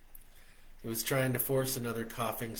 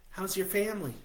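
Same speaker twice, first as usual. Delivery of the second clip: higher, brighter woman's voice; Stella's voice; 9 semitones higher, 3 dB louder, faster than usual. A narrator's reading. Stella greeting him on the phone, much higher. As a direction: lift into a happy woman on the phone.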